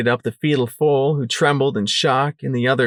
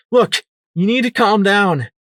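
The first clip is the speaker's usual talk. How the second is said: urgent calming; 9 semitones higher, 4 dB louder than usual. Acted. Trying to calm the mare.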